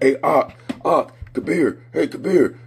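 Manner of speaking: high-pitched mock calling at the door; imitated tattling brothers. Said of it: "five people in my door like".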